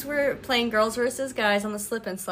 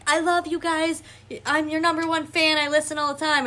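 higher-pitched mock superfan; pitched-up, teasing fan impersonation; gushing fan; 6 semitones higher than usual. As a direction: imitate an adoring fan's gushing. Mocking a fan's gushing texts.

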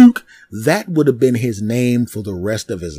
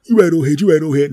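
louder, grudging repeat; mumbled agreement; 5 semitones higher, 5 dB louder, faster than usual. Retold or imitated. Bottle guy grudgingly agreeing with the older man, louder. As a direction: concede grudgingly.

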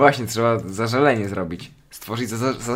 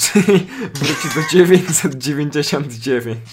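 laughing; 3 semitones higher, 4 dB louder than usual. Real, not acted. Laughing at his own joke.

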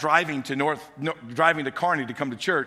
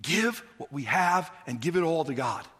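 ordinary talk

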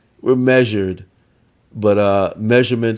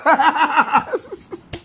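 high laugh. Real, not acted. Laughs at jumping crowd.

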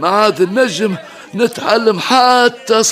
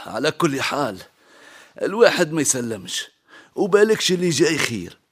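tender reassuring; 7 semitones lower, 6 dB quieter, faster than usual. Acted. Father softly comforting daughter.

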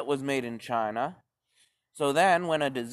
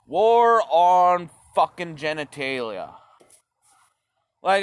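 usual speaking voice

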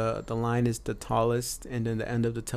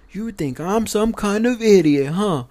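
mock drill instructor; 7 semitones higher, 7 dB louder, slower than usual. Drill instructor mocking the trainee.

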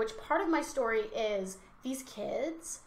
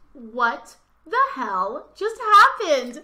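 stunned disbelief; 3 semitones higher, 11 dB louder, much slower than usual. Retold or imitated. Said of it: "And I was just like".

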